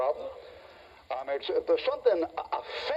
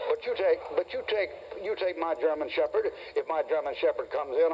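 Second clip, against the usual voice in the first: usual speaking voice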